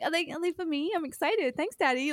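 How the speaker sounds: a hint of a sweet little girl asking for a gift; mock girly voice. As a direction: play a smitten girl teasing Daddy. Girlish voice thanking Daddy again.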